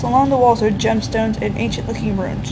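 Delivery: normal talking voice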